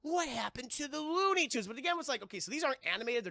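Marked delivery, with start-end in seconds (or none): 0.0-1.7 high-pitched puzzled question; mock childlike bewilderment; bewildered kids. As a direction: imitate confused children, pitched up. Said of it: "And they're like".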